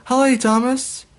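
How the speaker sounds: put-on a crowd of kids greeting the engine; group greeting. A narrator's reading. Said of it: "Everybody said".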